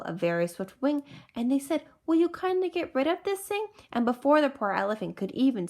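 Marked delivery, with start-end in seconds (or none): 2.1-3.7 high-pitched monkey voice; put-on polite request. A narrator's reading. Monkeys ask eagle to dump clover, high-pitched.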